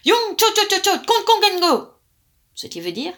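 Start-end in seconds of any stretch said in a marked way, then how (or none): 0.1-1.9 much higher and louder furious yell; angry shout. A narrator's reading. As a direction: roar it at the sorcerer in rage. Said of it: "se mit à crier très fort".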